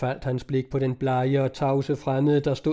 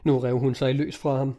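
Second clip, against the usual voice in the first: ordinary delivery, nothing marked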